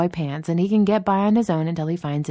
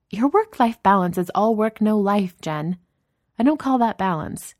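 higher, louder voice for a scolding boss; lightly exasperated, raised-pitch boss voice; Danny, teasing boss; 6 semitones higher, 5 dB louder than usual. Acted. Boss scolding a colleague about work-life balance, teasing exasperation.

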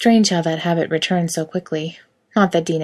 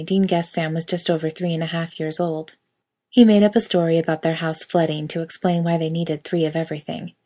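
ordinary delivery, nothing marked

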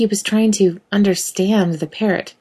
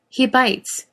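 warning; 7 semitones higher than usual. Acted. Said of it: "she warned".

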